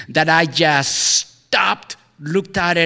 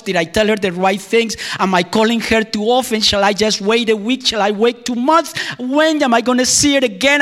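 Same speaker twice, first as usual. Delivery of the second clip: anxious lovesick thoughts; 6 semitones higher than usual. Retold or imitated. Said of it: "I go to myself and I say".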